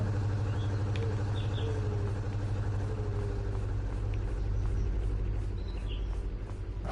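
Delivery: long high villain cackle; evil cackle. Acted. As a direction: cackle like a madman over your victims. Evil villain laugh while playing.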